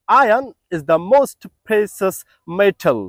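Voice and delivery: Iron is the most precious metal read very slowly; slow model sentence. Shown as a demonstration. Teacher dictating a model sentence slowly to learners. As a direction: dictate it word by word. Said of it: "sentence make করতে পারেন".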